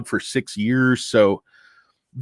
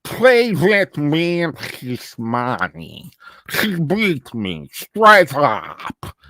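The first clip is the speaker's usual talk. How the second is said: put-on slow, thick Russian mobster accent; Teddy KGB; 3 semitones higher, much slower than usual. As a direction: draw it out like a Russian mafioso. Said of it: "Teddy K G B impression".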